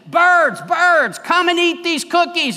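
high, loud call to the birds. Acted. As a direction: call the birds from afar with full voice. Frog calls out to birds.

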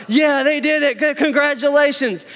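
high, loud congratulations; cheering fan. Retold or imitated. Fans cheering the Super Bowl winners, high and loud. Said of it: "they're like".

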